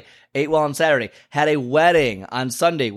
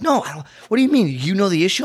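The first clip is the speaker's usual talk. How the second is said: baffled client; 6 semitones higher, faster than usual. Retold or imitated.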